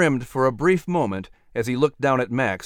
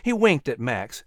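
plain reading voice

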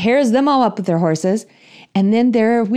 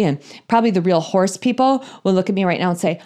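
ordinary talk

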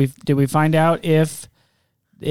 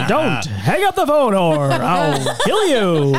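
creepy caller voice; 2 semitones higher, slower than usual. Pretend. Phone caller threat bit.

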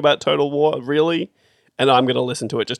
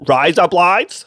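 broad mock-Australian, higher and louder; exaggerated Australian accent; 4 semitones higher, 5 dB louder, much slower than usual. Co-host showing how Americans think we talk, exaggerated Australian accent. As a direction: overdo the Australian vowels, questioning tone. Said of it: "They think we're saying".

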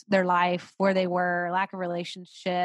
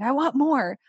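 mock tantrum; 6 semitones higher, 6 dB louder than usual. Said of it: "temper tantrum moments of being like".

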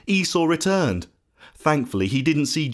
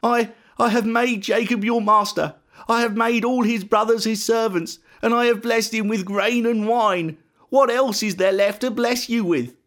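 high-pitched, stammered start, then dismayed; acted upset old father; helpless distress; 8 semitones higher than usual. A narrator's reading. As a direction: explain helplessly that nothing is left. Isaac helpless before weeping son.

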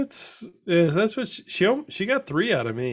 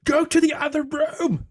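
put-on much higher, bossy little kid ordering dad out; dad imitating his toddler son banishing him; 3 dB louder than usual. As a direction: squeak it like a bossy two-year-old. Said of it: "That's what Jack said?".

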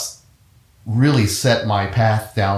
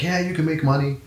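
higher-pitched reassuring reply; lightly voiced friend, pitched up; quoting a friend; 6 semitones higher, 3 dB quieter than usual. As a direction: brush off the doubt, higher. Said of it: "he's like".

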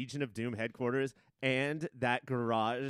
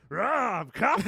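loud, higher growling outburst; mock high-on-heroin shout; 9 semitones higher, 8 dB louder than usual. Friend retelling the movie heroine, loud mock shout. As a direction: bellow it like she's wildly wired. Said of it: "she was like".

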